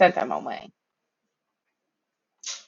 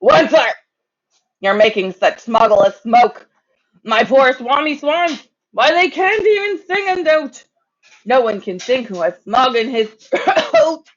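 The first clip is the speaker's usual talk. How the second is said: croaky cry; 6 semitones higher than usual. A narrator's reading. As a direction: cry out hoarsely in protest. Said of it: "he cried with a cruffless croak".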